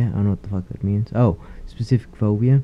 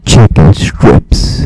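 loud, slow, awed "Chicken strips"; awed reveal; 14 dB louder, much slower than usual. Retold or imitated. Seeing chicken strips on the menu, a loud slow awed reveal. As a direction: draw it out like finding treasure.

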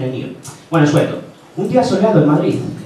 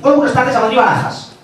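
higher, louder co-driver voice; Luis Moya; 4 semitones higher, 3 dB louder, faster than usual. Acted. Rally co-driver greets the taxi driver, high excitable Moya impression. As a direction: fire off the destination. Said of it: "Es Luis Moya".

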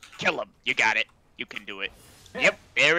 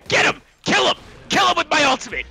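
battle cry; 9 semitones higher, 4 dB louder, much faster than usual. Real, not acted. Rallying team in game.

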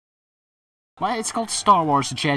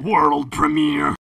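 mock announcer; 2 semitones higher, 7 dB louder, much slower than usual. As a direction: ham up the trailer voice. Mimicking announcer.

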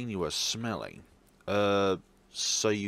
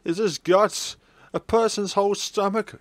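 high, loud shocked questions; horrified disbelief; 10 semitones higher, 6 dB louder than usual. Acted. Classmate staring at the remains, high horrified questions. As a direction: recoil in horror as you ask.

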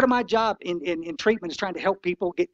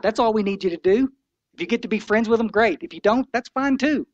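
ordinary talk, nothing marked

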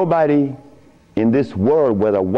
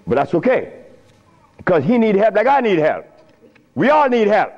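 ordinary talk, nothing marked

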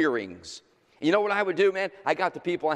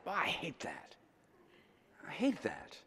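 much softer, almost under the breath; hushed self-reproach; 3 semitones higher, 10 dB quieter than usual. Real, not acted. Preacher admitting his old self comes out, hushed self-reproach. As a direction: drop to a private confession. Confessing his own short temper.

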